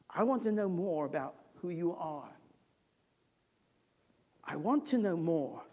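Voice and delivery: quietly voiced visitor, low; earnest request. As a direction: ask it low and gently. Acts out the visiting rabbi's request.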